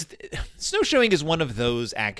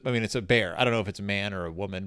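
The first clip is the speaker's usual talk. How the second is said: ordinary talk, nothing marked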